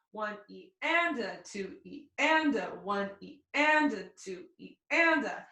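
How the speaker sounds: one E and a, with the and leaned on; counting sixteenths. Shown as a demonstration. Fiddle teacher counts sixteenths for the class, leaning on and. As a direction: chant the subdivisions, leaning into and. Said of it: "So that's our rhythm there".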